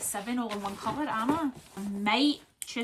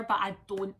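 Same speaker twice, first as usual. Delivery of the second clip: ordinary delivery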